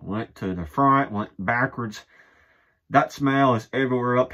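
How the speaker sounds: speaking through cloth held over mouth; muffled through shirt. Real, not acted. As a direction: hand and fabric covering mouth. Seen 1: Gray shirt held over mouth and nose by hand throughout.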